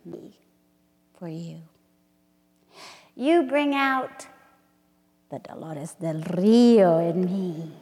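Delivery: slow, intimate poem recital with hushed drops; dramatic spoken-word reading; poetry reading. Acted. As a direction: read it like a love incantation. Reads her poem aloud.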